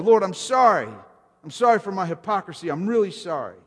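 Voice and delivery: acted confession. Sinners confessing to God, emphatic and pleading. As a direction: hammer out each "sorry" high, letting it fall.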